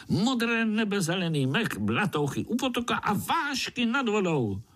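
high-pitched enthusiastic little creature; little spider hero. A narrator's reading. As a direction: list the wonders in a high, thrilled voice. Pája sells the forest. (vysoký nadšený hlásek pavoučka)

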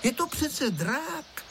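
high, slow, emphatic explanation; decisive. A narrator's reading. Girl explaining the kite's face, decisive. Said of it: "řekla rozhodným hlasem Tereska".

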